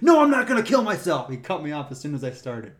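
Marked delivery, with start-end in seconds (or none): 0.0-1.3 higher, louder angry denial; irritated denial. Retold or imitated. Cyclist cutting off the ranger, loud angry denial. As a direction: cut in hard, annoyed.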